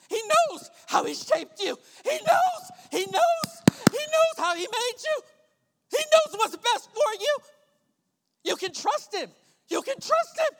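high, shouted pulpit climax; impassioned preacher's shout, easing at the end; full-force preaching. Real, not acted. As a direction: hammer each phrase at the congregation.

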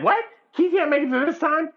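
high-pitched mock disbelief; incredulous. Friend retelling bad news about Keith, incredulous. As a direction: repeat it back like you can't believe it.

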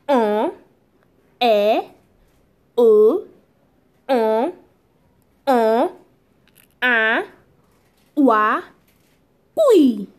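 "en, e, o, a, wa, oui" each alone, with pauses; French sound drill. Shown as a demonstration. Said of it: "I will take it one more time".

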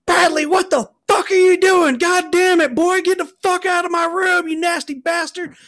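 very high, loud screech; screaming mom. Retold or imitated. Mom catching son in her room, screaming. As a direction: scream like an outraged country mother. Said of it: "I hear his mom scream".

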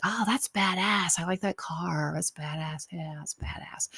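hushed mock admiration, fading into whisper; imitating impressed onlookers. Caitlin and Tayshia admiring the old car, hushed impression. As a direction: murmur it like onlookers aside, trailing to a whisper. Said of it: "Caitlin and Tayshia were like".

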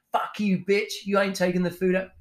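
mock London accent, pitched high; dubious London accent. Mocking another chef who abused a waitress, bad London accent. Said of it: "That's a London accent".

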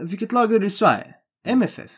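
asking like a keen Greek fan cop; imitating the cop's eager questions. Retelling the border cop's football questions, mock eager.

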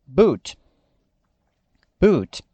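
"boot" with a long oo and crisp final t; model English word. Shown as a demonstration. Teacher modeling vocabulary for students, clear English. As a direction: say "boot" cleanly, no extra vowel. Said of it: "Próxima palavra".

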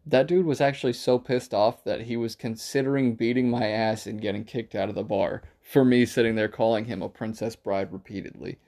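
usual speaking voice

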